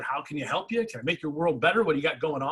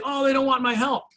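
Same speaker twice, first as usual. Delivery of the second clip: higher, mock-wounded whine of rejection; mock hurt saleswoman; 7 semitones higher, 4 dB louder than usual. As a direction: lightly play a saleswoman feeling rejected.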